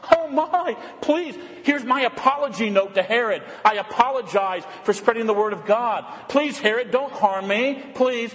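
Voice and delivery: high, cringing sham apology; mock fearful pleading. Imagined Jesus begging Herod not to harm him, mock fearful pleading. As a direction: plead like a hammed-up coward. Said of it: "He doesn't cry out in".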